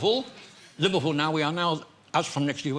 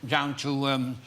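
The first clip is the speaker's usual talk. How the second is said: ordinary talk, nothing marked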